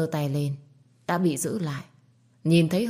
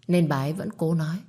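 plain reading voice

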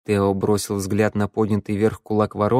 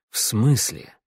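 hoarse; slower than usual. A narrator's reading. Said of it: "прохрипел Тео". (хрипло)

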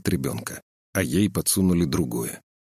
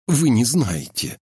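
agitated plea; 5 semitones higher, 3 dB louder, slower than usual. A narrator's reading. Agitated medic protests.

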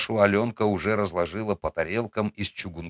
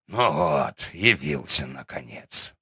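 put-on lower, slow grumbling old man; ворчливый старик; 6 semitones lower, slower than usual. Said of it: "проворчал дед Игнат". (низкий медленный ворчливый старик)

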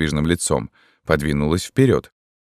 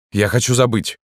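raised, insistent wish; agitated; 6 semitones higher than usual. A narrator's reading. Distraught young man tells hypnotist his wish, agitated. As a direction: insist on it, worked up. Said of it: "с возбуждённым лицом".

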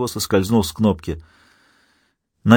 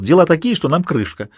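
higher, frantic pleading; panicked; 6 semitones higher than usual. Acted. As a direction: blurt it out in panic. Trapped Garcia begs for rescue.

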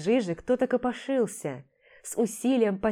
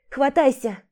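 urgent call down; shouting; 5 semitones higher, 5 dB louder than usual. Acted. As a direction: call down the pit, grab on. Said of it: "крикнула".

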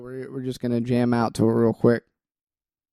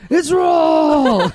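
much higher, louder chef shout; Gordon Ramsay yell; 14 semitones higher, 5 dB louder than usual. Retold or imitated. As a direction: yell it's raw like an angry chef. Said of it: "Like, Gordon".

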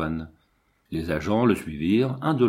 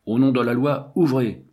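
raised, commanding voice of the law; hard, imperious; 4 semitones higher, 4 dB louder than usual. A narrator's reading. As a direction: order them firmly to open up. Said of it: "une voix dure, impérieuse". (voix dure et impérieuse)

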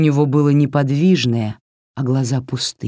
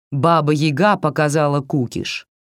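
plain reading voice

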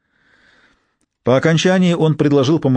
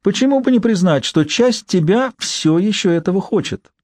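higher, teasing jab; mocking inner voice; 7 semitones higher than usual. A narrator's reading. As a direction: taunt yourself with a sneer. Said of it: "ёрничал голос в моей голове". (насмешливый внутренний голос)

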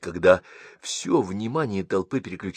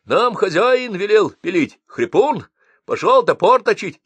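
much higher, loud shouting; yelling; 12 semitones higher, 6 dB louder than usual. Acted. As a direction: shout over the commotion. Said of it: "орал старшина".

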